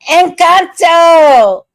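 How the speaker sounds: high, loud, drawn-out shout; exuberant shout. Real, not acted. Shouting a beloved movie's title, exuberant shout. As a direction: belt the title out long and high.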